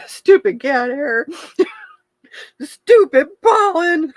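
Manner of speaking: loud, high, drawn-out teary wail; tearful joking denial. Real, not acted. Host covering real tears before viewers, loud comic wail. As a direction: blame the tears with a laughing cry. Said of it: "I'm not crying".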